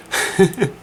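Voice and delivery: high giggle bursting out; a quick high laugh at the joke; burst of laughter. Real, not acted. Laughing at Patty being a girl's name, real high giggle.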